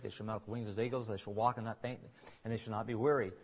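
ordinary talk, nothing marked

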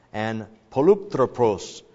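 slow poluptropos, each syllable deliberate; careful Greek word. Shown as a demonstration. Pastor sounding out Greek for the church, careful. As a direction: spell poluptropos out with the voice. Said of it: "I practiced this last night".

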